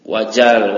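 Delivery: wajal said loud and firm, slowly; teacher correcting a reading mistake, firm; firm correction. Shown as a demonstration. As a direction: stress wajal to correct the student. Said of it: "wajal itu beda".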